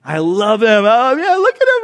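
high, loud gushing parent; excited doting parent. Retold or imitated. Parent filming child in school play, excited high gush. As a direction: gush over the kid on stage.

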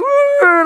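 very high loud mock baby cry; high wailing baby imitation. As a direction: squeal like a fussing baby. Baby crying again.

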